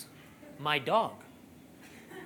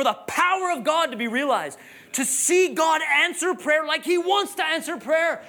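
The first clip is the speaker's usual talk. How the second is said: pitched-up, forceful sermon delivery; impassioned preaching; 7 semitones higher, 3 dB louder than usual. Real, not acted. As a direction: drive the point home to the congregation.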